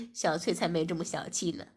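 male lead's voice. Acted. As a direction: speak as the calm young boss. Narrator voices male lead. (低沉的男主角声音)

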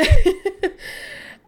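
loud high cackle breaking out; a big laugh at her own bad luck; big burst of laughter. Real, not acted.

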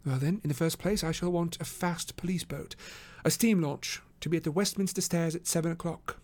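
high, even voice for Holmes; businesslike detective. Acted. Holmes ordering a police boat, businesslike.

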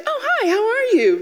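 high-pitched sugary greeting; super friendly. Retold or imitated. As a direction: gush a fake-friendly mom hello. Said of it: "super friendly".

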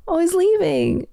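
high sing-song pet voice; animal voice. Real, not acted. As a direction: coo at the cat. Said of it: "my animal voice".

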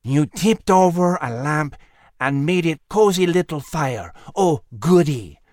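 sinister mocking voice from the dark; menacing, sarcastic sneer; sneering villain. A narrator's reading. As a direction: sneer it with mock delight. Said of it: "The voice sneered in a tone".

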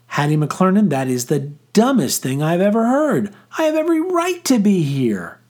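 indignant, climbing very high at the end; voice rising octaves. A narrator's reading. Girl insisting on her right to stay, voice rising shrilly. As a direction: build from scolding to high-pitched outrage. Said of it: "Her voice rose several octaves".

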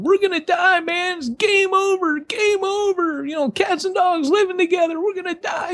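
high, frantic Bill Paxton panic; mock panic, way up high; panicked freakout. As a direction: squeal it like a soldier losing his nerve. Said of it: "Bill Paxton's freaking out going".

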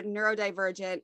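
ordinary talk, nothing marked

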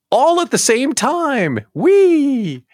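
high, drawn-out fake cheer; mock glee. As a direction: celebrate bad news with a squealed whee. Mock cheer over bad economic news.

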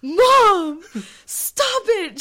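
loud, very high mock-embarrassed wail; mortified whine. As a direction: whine like a mortified daughter, hammed up. Mortified daughter answers her mother.

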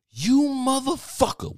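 high, drawn-out angry woman's yell; mock high-voiced fury; imitating her outburst. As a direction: cuss him out, stretched and high-pitched. Angry woman cussing him out.

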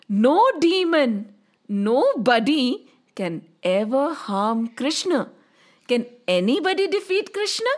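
plain reading voice

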